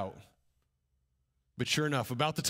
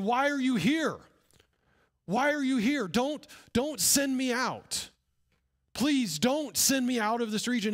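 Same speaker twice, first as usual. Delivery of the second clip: pleading shout; 8 semitones higher, 3 dB louder than usual. Retold or imitated. Possessed man shouting at Jesus.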